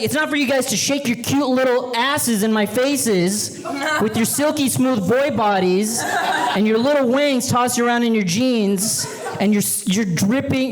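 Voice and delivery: a hint of a leering coach scolding the boys; creepy coach. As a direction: savour the words like a creepy coach.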